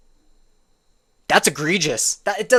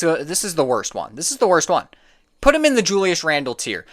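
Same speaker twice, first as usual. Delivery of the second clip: ordinary talk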